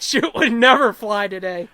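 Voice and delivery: ordinary talk, nothing marked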